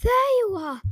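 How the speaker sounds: sharp, high call across the room; shouting. A narrator's reading. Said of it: "her mom shouted".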